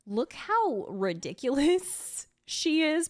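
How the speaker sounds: ordinary delivery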